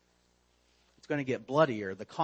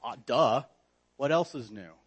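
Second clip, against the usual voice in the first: dismissive duh; 2 semitones lower, 3 dB louder than usual. Retold or imitated. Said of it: "the knee-jerk reaction would be".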